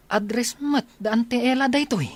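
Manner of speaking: high, startled exclamation; shocked realization. Acted. Friend realizing the address is Ella's, high surprised outburst. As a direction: gasp out the discovery.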